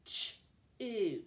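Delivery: CH as a lone consonant, then a held OO; sounded out. Shown as a demonstration. Teacher splitting a word into two sounds, sounded out. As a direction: break it into two separate sounds, CH and OO. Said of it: "Two sounds".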